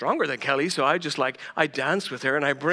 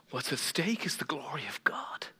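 intense hush; 3 semitones lower, 7 dB quieter than usual. Real, not acted. Sermon emphasis.

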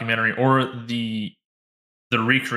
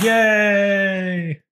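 excited cheer; 10 semitones higher, 4 dB louder than usual. Real, not acted. Celebrating spooky season.

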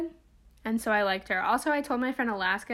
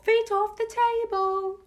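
higher repeat; 11 semitones higher, 4 dB louder than usual. Retold or imitated.

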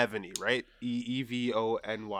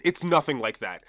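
ordinary delivery

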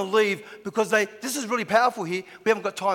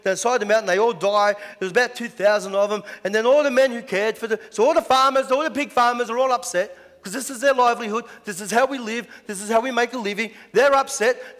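ordinary delivery

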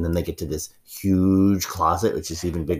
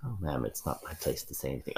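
quiet; 4 semitones lower, 13 dB quieter than usual. Acted. Butler demurs quietly.

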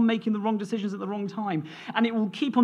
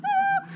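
frustrated cry; 4 dB louder than usual. Retold or imitated. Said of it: "he was like".

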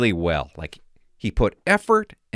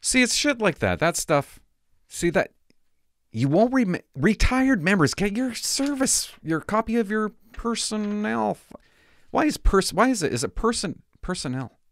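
excited ramble; 10 semitones higher than usual. Real, not acted. Excited high-pitched rambling.

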